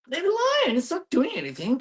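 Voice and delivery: high, fed-up plea to leave it be; exasperated. Real, not acted. Telling snake-panicking neighbours to leave it alone, high and exasperated. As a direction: throw your hands up at the snake fuss.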